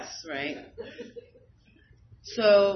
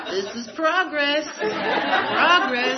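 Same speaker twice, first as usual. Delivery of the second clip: higher, louder, laughing through the words; 7 semitones higher, 8 dB louder, much slower than usual. Real, not acted. Telling the group about her progress, laughing. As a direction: laugh it out with proud delight.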